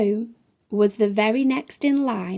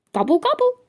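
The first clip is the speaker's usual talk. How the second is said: high, beaming turkey voice; grinning turkey; 8 semitones higher, much faster than usual. A narrator's reading. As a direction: gobble it out with a big grin. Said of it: "replied Toby with lots of cheer".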